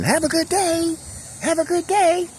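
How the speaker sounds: high, sing-song shouted greeting; cheerful yell. Retold or imitated. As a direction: call out brightly to passing cars. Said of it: "waving and yelling".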